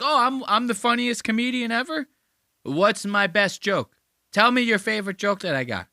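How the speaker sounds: high-pitched, hammed-up braggart; mock boastful comedian. Comedian on Tony Hinchcliffe's show, mock-boastful. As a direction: push it up high like a needy show-off. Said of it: "if I was one of those comedians".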